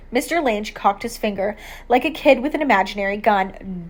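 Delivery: plain reading voice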